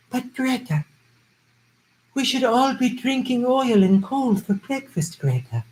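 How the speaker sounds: high, slowed mock old granny voice; grandma impression. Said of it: "Love the grandma climate change denier video".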